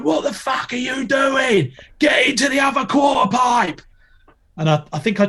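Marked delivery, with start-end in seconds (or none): 0.0-3.8 angry yell. Retold or imitated. Coach figure yelling at skater, furious high shout. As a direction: roar it at the skater from the deck. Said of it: "He's like".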